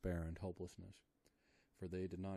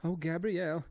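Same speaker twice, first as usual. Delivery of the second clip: high, loud terrified cry; woman waking in terror screaming; 11 semitones higher, 9 dB louder than usual. A narrator's reading. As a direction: scream it in fright. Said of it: "she screamed".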